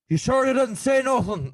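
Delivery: put-on high, loud drunken challenge; drunk guy. Drunk man in a bar picking a fight, high and loud. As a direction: goad him like a hammered local. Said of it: "he was hammered".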